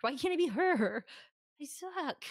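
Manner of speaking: loud, high whine of envy; mock jealous groan; envious fan. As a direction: wail at your own inadequacy. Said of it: "and you're like".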